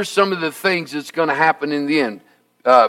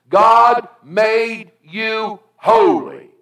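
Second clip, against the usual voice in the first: slow emphatic; 4 semitones higher, much slower than usual. Acted. Preacher slows for key line.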